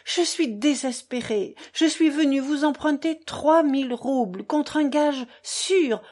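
ordinary delivery

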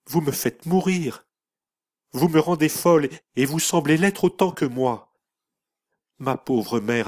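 voix haute, au bord des larmes, angoissée; plaide, désespérée et révoltée; en sanglots. A narrator's reading. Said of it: "éclata en sanglots, d'angoisse et de colère". (fille accusée, angoisse et colère mêlées de pleurs)

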